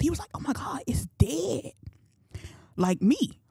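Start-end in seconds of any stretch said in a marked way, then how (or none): 0.3-1.7 high, half-whispered gasp of disbelief; hammed-up hushed astonishment, pitched up high; shocked disciple. Peter seeing the withered tree, shocked. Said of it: "he was shocked".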